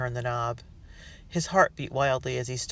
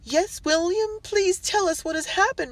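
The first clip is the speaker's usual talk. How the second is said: high, anxious pleading; wife pleading with her husband from the landing; 4 dB louder than usual. A narrator's reading. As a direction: beg her husband to explain.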